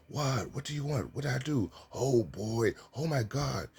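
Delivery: high-pitched, exasperated student; imitated student outburst; back-talking student. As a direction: protest high, each phrase swooping down. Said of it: "young gentleman say".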